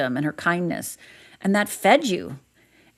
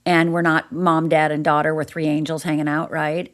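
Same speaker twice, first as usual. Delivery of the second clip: ordinary delivery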